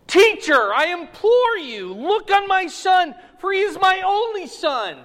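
high, loud pleading cry of a desperate father. Retold or imitated. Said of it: "he cries out to the Lord Jesus".

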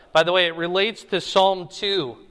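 usual speaking voice

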